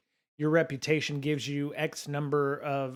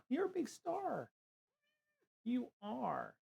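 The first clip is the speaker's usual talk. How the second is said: much higher, softer cooing voice for the pet; high, soft sing-song pet talk; 12 semitones higher, 6 dB quieter than usual. Real, not acted. Talking baby talk to pet.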